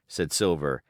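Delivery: usual speaking voice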